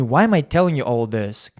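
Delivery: normal talking voice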